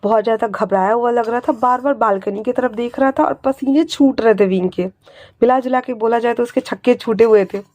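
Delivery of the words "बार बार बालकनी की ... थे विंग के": ordinary delivery, nothing marked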